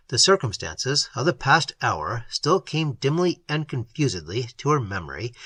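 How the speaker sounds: usual speaking voice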